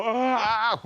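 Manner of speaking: high, loud scared shriek; panicked yelp. Retold or imitated. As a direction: yelp in sudden panic at the ball. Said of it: "I panic, and I go".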